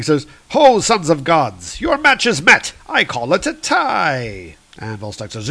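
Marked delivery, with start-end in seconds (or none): 0.5-4.6 celebrating Fandral. Acted. Fandral celebrating the match of gods, hammed-up proclamation. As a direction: hail the fighters in a raised celebratory voice. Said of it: "Fandral is celebrating".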